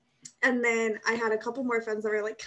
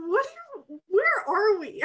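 incredulous; 9 semitones higher, 5 dB louder, slower than usual. Retold or imitated. Stunned by eighth grade gossip.

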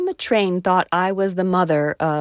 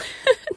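embarrassed laugh. Real, not acted. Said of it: "I cannot say that word".

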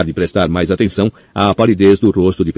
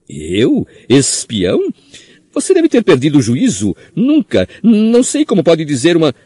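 frantic high denial, rising to a squeal; panicked squeaky voice; 10 semitones higher than usual. A narrator's reading. Pettigrew denies in panic.

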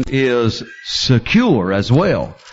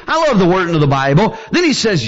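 usual speaking voice

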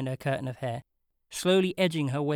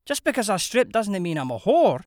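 louder, higher indignant retort; affronted; 7 semitones higher, 7 dB louder than usual. A narrator's reading. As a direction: snap back, offended. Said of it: "genuinely affronted".

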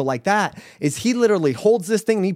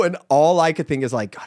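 ordinary talk

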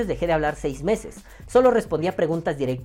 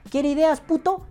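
reto burlón, mucho más agudo; reto desafiante; 10 semitones higher than usual. Pretend. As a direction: suelta el reto como quien provoca. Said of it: "y yo te diga". (desafío burlón en voz aguda)

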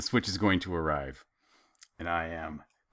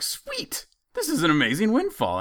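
mock delight; 13 semitones higher, 3 dB louder than usual.

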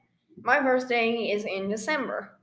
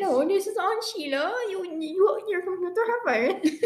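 higher put-on scammer accent, broken English; fake scammer accent; 7 semitones higher than usual. Friends mocking a scam caller, high fake accent. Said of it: "fake accent".

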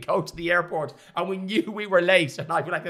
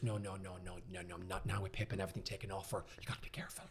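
much softer worried mother; mother's hushed warning; 6 semitones lower, 17 dB quieter, faster than usual. Retold or imitated. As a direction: hush it like a fretting mum. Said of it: "She goes".

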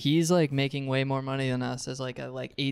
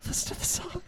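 whispered; 8 dB quieter than usual. Pretend. Whispered ASMR line.

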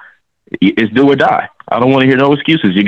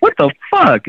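a high, incredulous what the fuck; mock outburst from the owner's box; imitating Mark Davis; 7 semitones higher than usual. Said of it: "he was pissed".

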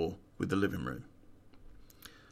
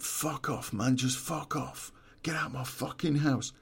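higher-pitched frantic warning, words tumbling; panicky druggie; 5 semitones higher than usual. Retold or imitated. Druggie with bat warning armed intruder, high panicky voice. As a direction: imitate a jumpy stoner waving a bat. Retold druggie telling him off.

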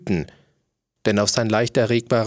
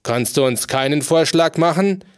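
impatient; 4 semitones higher, 3 dB louder than usual. A narrator's reading. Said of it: "sagte der König ungeduldig".

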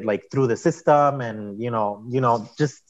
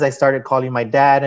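ordinary talk

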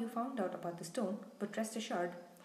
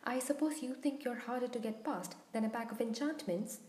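usual speaking voice

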